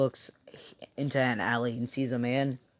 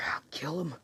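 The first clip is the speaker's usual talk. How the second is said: whispering; 3 semitones higher, 9 dB quieter than usual. Retold or imitated. Deku vows revenge.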